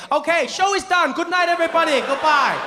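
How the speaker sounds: high-pitched hurried sign-off; panicked showman. Acted. Bitten charmer dismissing his audience, high and panicky. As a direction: wrap up the show in a high, alarmed voice.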